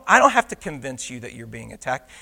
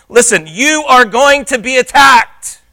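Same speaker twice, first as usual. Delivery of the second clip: loud, raised-pitch warning; very emphatic; 7 semitones higher, 7 dB louder than usual. Acted. Preacher voicing the apostle's warning of attack, very emphatic. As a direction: press it home as a certainty. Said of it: "he's being very emphatic here".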